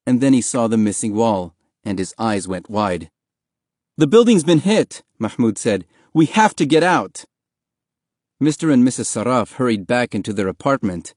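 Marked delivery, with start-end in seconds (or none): 4.0-5.0 raised, louder cry of warning; high, loud and alarmed; urgent alarm. Acted. Warns neighbors after the blast. 6.1-7.2 high-pitched, pressing plea; raised voice full of urgency; urgent warning. Acted. Urges neighbors to flee.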